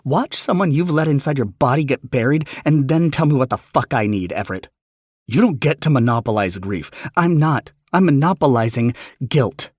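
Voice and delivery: loud, high angry outburst; furious. Retold or imitated. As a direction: scream it back in grief. Heated family argument.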